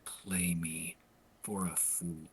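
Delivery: low, very slow menacing whisper; stone-cold menace. Acted. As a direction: whisper it as an icy warning. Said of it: "that stone cold look".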